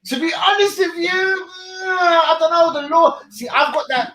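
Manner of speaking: high, pained voice through laughter; strained laughing reply. Real, not acted. Host answers the Bentner question, laughing and pained. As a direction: squeeze it out while cracking up. Reacting to Bentner comparison joke.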